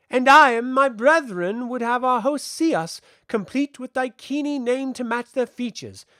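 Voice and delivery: put-on high, loud, declamatory child figure; bold child envoy, proud. A narrator's reading.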